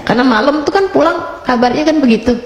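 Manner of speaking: usual speaking voice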